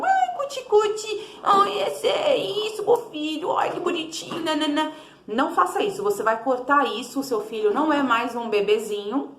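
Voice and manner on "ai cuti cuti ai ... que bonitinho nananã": put-on baby talk. Shown as a demonstration. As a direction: coo at the baby the wrong way. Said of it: "aquela voz de bebezinho". (voz de bebezinho aguda e melosa)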